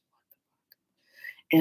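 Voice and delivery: slow, stunned whisper; whispered disbelief. Retold or imitated. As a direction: whisper it under your breath in disbelief. Muttered aside on seeing the arrest.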